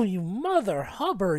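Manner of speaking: normal talking voice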